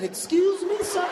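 high, rising mock disbelief; startled question. Protester questioning the wounded man, startled high voice. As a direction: question him as if you misheard. Acting his startled reply to victim.

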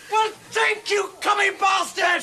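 sarcastic shouted insult, slowing down; furious, drawn-out shout; angry yelling. Acted. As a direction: yell at the useless doctor. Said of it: "don't yell at me".

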